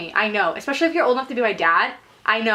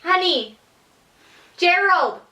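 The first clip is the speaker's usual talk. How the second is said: higher-pitched calls for a partner; calling out; 6 semitones higher, slower than usual. Retold or imitated. Imitating a customer calling for her partner mid-order, calling out. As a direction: call out each name and wait.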